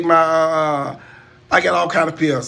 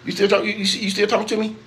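mock taunt; 5 semitones higher than usual. Teasing cameraman with repeated question.

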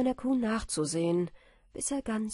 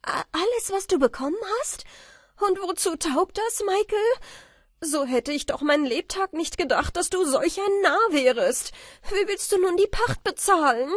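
much higher, louder shrieking disbelief; outraged wife; 10 semitones higher, 7 dB louder than usual. A narrator's reading. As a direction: scold him in shock. Marie entsetzt und wütend. (Marie entsetzt und empört, viel höher und lauter)

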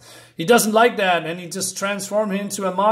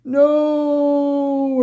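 mock anguished cry; 9 semitones higher, 5 dB louder than usual. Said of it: "And she says".

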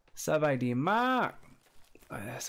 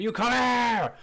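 shout at rabbit; 9 semitones higher, 5 dB louder, slower than usual. Real, not acted. Yelling at fleeing animal.

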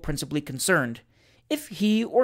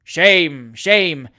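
louder cry of protest; raised, disapproving call; remonstrating; 6 dB louder than usual. A narrator's reading. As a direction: protest firmly from the audience. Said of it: "remonstrated the ornamental painter's journeyman's party".

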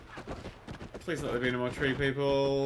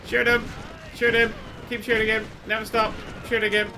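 loud high barked commands; urgent shouting; 8 semitones higher, 6 dB louder than usual. Real, not acted. Player urging himself mid-fight, urgent shouting. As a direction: drive yourself on, yelling.

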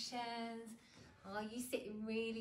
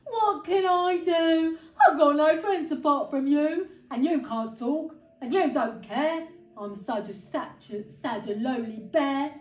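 hammed-up tearful bear voice; crying bear; 2 semitones higher, 14 dB louder than usual. A narrator's reading. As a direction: wail it out as a lonely big bear. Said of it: "started to cry".

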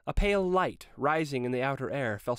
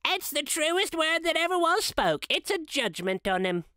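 put-on high, eager, agreeing old woman; curry favour in a shrill eager voice; fawning Mrs Dilber; 7 semitones higher than usual. A narrator's reading. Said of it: "whose manner was remarkable for general propitiation".

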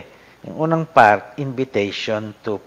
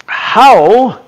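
"how" much higher and louder, drawn out; stress on how; 11 semitones higher, 12 dB louder than usual. Shown as a demonstration. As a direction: hit "how" hard and high. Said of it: "very significant tong how na ito".

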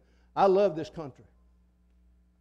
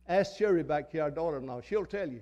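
ordinary delivery

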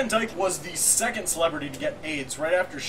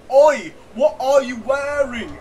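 high, loud, drawn-out Cockney; 7 semitones higher, 10 dB louder, slower than usual. Retold or imitated. Asking the caller about clothes, hammed-up Cockney. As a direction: belt it out in fake Cockney.

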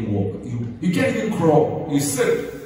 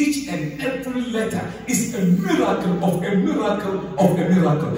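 mounting emphasis; 6 semitones higher than usual. Acted. Preacher builds repeated phrase.

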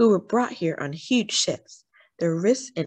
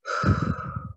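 deep sigh; 4 dB quieter than usual. A narrator's reading. Deep breath in the exercise.

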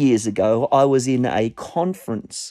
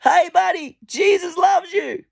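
retold long-range yell, pitched way up; shouting out. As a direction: holler it after someone walking off. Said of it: "So I shouted out to him".

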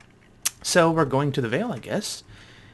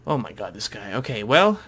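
usual speaking voice

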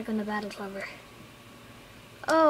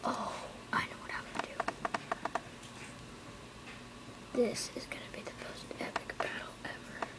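breathy secret whisper, eager; 11 dB quieter, faster than usual. Real, not acted. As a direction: gasp then hushed whisper. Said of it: "I'm sorry if I'm talking very quiet".

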